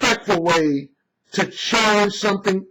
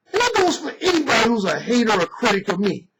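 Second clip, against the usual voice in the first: normal talking voice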